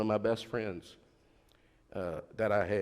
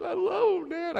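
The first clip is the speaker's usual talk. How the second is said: much higher mock girlish voice; daughter's voice. Daughter telling her dad she loves the boy, high girlish voice. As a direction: play the lovestruck daughter, pitched way up.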